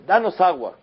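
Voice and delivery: loud, high demanding cry; demanding people. Retold or imitated. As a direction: demand water from Moshe. Pueblo exige agua a Moshe. (exigencia del pueblo en voz alta y aguda)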